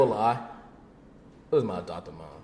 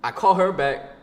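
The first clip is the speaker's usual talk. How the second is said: usual speaking voice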